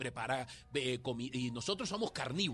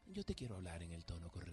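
much lower and much softer, fast; calm, gentle tone; 10 semitones lower, 10 dB quieter, faster than usual. Retold or imitated. Husband returning to his wife after a walk, gentle and soft. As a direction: ease back in gently, a peace offering. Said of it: "llego y digo".

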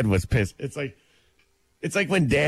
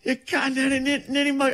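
imitating Amanda; 11 semitones higher than usual. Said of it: "she said it".

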